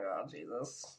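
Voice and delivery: low, soft dread under the breath; dismayed mutter. Retold or imitated. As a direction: drop it low like you've just been spotted. Dreading the guy's approach.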